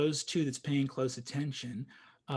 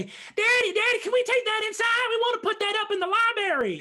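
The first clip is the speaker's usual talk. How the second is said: put-on high, loud, fast child voices; eager little kids begging daddy; excited kids; 9 dB louder, faster than usual. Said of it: "the kids look at the scroll and say".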